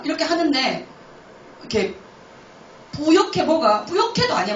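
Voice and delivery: ordinary talk, nothing marked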